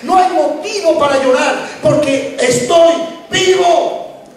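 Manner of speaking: rising, ringing proclamation; impassioned preaching. Acted. Preacher proclaiming Jesus' words of hope, impassioned. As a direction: build to a high, triumphant cry.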